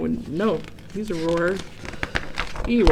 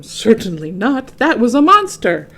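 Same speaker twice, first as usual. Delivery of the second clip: louder, rising tiger denial; Tiger's protest; 3 semitones higher, 7 dB louder than usual. A narrator's reading. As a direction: deny it hotly, then stress monster. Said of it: "said Tiger".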